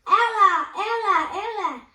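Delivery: loud, high calling out across the playground; shouting a name. A narrator's reading. Jack calling out for the lost girl, very loud shout. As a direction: shout her name, fading slightly each time.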